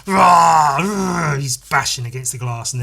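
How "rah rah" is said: short mock monster roar, loud; beast roar. Beast roaring in comic.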